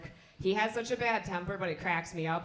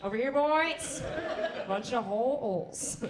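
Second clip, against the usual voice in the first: put-on higher, luring call to the boys; mock come-on call; 4 semitones higher, slower than usual. Comic voicing herself calling rapists over, mock inviting. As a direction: beckon like bait, playful. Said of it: "I'm just like".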